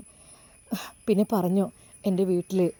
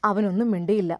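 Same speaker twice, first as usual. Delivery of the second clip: ordinary delivery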